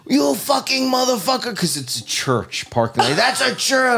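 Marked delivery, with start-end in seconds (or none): 0.1-1.6 high-pitched mock scream; strained high yell, not full volume; retold scream. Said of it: "He's screaming at me". 3.1-4.0 high-pitched mock scream; strained high yell, not full volume; retold scream. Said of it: "He's screaming at me".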